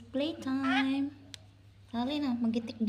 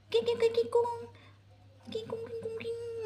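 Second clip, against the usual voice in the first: high-pitched rapid nonsense syllables; playful baby noises; 10 semitones higher than usual. Pretend. (tinis nga tingog pangdula sa bata)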